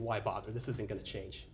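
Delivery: low, soft and defeated; hopeless quote. Retold or imitated. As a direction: mutter it like someone who gave up. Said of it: "where they're thinking".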